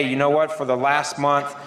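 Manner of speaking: ordinary talk, nothing marked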